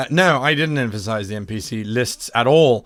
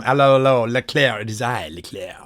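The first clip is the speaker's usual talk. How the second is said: hammy French spy introducing himself; French accent; 3 semitones lower than usual. Pretend. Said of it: "that's where the accent came from".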